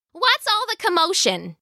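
high-pitched wife's mild yell; lightly acted shout in a high voice; yelling back. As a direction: call back, wanting to know the fuss. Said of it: "Missus Hacktack yelled back".

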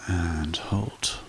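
slow, calm meditation guide voice; guided meditation. Acted. Meditation host guiding listeners to relax, calm and unhurried. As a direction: ease the listener down into stillness.

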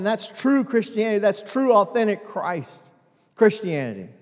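loud, driving sermon climax, building and insistent; full-force preaching. Acted. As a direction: preach it out to the whole church.

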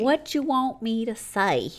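retry the Texan accent, stretching it out; exaggerated Texan twang. Shown as a demonstration. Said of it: "That was not Texan".

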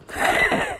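high squeaky burst of laughter; laughing at own joke. Real, not acted. Host cracking up at his own joke, squeaky laugh. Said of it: "I'm laughing at my own jokes".

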